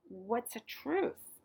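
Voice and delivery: put-on slow, questioning child's voice; curious girl. A narrator's reading. Luca asking grandmother, slowly puzzled. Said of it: "I asked".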